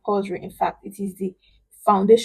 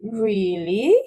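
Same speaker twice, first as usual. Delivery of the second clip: REALLY drawn out, dipping then rising; doubtful dip and rise. Shown as a demonstration. Someone in doubt, dipping and rising "Really". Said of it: "when you are in doubt".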